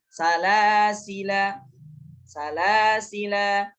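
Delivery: Salasila chanted slowly, long final -la; final alif read. Shown as a demonstration. Teacher shows stopping on Salasila, alif held long. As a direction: intone Salasila, stretching la and the last a. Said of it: "ketika waqaf alif dibaca".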